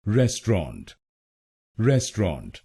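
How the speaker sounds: Restaurant as RES-tront, weight on res; restaurant said RES-tront, first syllable stressed. Shown as a demonstration. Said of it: "पहले शब्दांश पर RES पर जोर".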